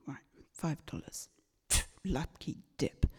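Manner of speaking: quiet, half-whispered echo; hushed repeat. Retold or imitated. Repeating the shop lady's price, softly whispered. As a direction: murmur it back, unsure of yourself.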